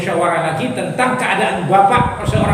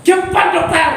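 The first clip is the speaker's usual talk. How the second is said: high, urgent mock plea; frightened and tearful; 8 semitones higher, 3 dB louder than usual. Frightened patient hurrying his doctor, tearful. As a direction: hurry the doctor in an acted panic. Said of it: "takut dia gemetar sambil menangis".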